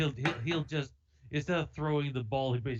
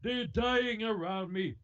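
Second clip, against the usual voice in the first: higher, hammed-up fretting cleric; mock impatient priest; 8 semitones higher than usual. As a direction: fuss like a priest with dying parishioners.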